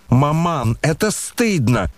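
slow, high mild outcry; indignant cry, narrated. A narrator's reading. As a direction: cry out in reproach, drawing out the words. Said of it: "громко вскричала Аглая".

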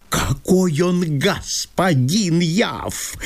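slow, drawn-out mocking echo of her words; mocking mimicry. Chertopkhanov mocking Masha's words about Yav, slow and sneering. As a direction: mimic her to ridicule the rival. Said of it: "передразнил её Чертопханов".

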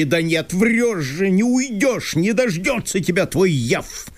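high, agitated landowner in disbelief; Chertopkhanov voice. Acted. Landowner reproaching Masha for leaving, high and fretful.

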